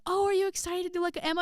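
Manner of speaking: very high, put-on eager voice; gushing choir teacher. Choir teacher hopes for another Emma, gushing.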